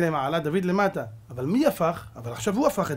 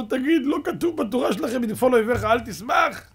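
high, loud aggrieved challenge; loud protest; 8 semitones higher, 6 dB louder than usual. Acted. Kicked Haman quoting scripture at Mordechai, high loud protest. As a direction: protest loudly as if just kicked.

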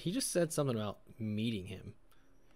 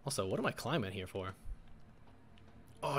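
usual speaking voice

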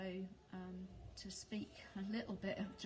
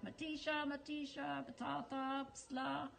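higher-pitched imitation of a street seller's call; raised-pitch market cry, only mildly projected; mock market shout; 6 semitones higher than usual. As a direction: call out produce like a vendor, without real volume. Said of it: "people shouting out".

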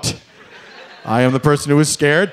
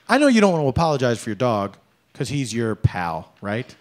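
ordinary delivery

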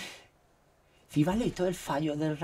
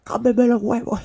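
much higher, louder laughing retort; 11 semitones higher, 17 dB louder than usual. Retold or imitated. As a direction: crack up through the punchline. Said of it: "Y le dije mi apellido".

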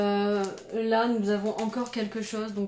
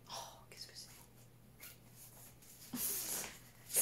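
barely audible breath of wonder; awed whisper; 9 semitones higher, 23 dB quieter, slower than usual. Real, not acted. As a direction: whisper it, amazed. Stunned by new item. (chuchotement émerveillé)

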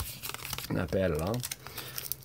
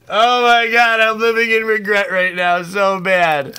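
higher, louder wail of regret; anguished excitement; 8 semitones higher, 8 dB louder than usual. Real, not acted. Watching a friend's big pull, anguished and loud. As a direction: wail it like it physically hurts. Regretting giving away the box.

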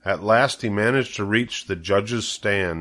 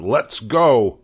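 loud rallying cry; calling out; 3 semitones higher, 5 dB louder than usual. A narrator's reading. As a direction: send the crew off with a call. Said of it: "cried Mister Zuckerman".

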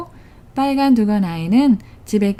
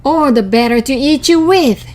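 loud, higher wolf snarl; 5 semitones higher, 6 dB louder than usual. A narrator's reading. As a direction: snarl it with menace. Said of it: "snarled the wolf".

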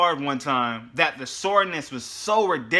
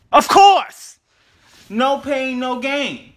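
loud exclamation; 9 semitones higher, 9 dB louder, much slower than usual. Real, not acted. Answering a dumb question.

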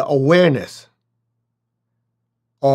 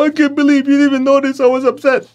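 high, louder wounded complaint; hurt complaint; 12 semitones higher, 5 dB louder, faster than usual. Retold or imitated. Upset friend accusing the other of not noticing, high and wounded. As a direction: play the one left unnoticed and upset.

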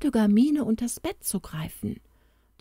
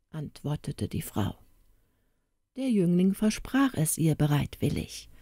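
ordinary delivery, nothing marked

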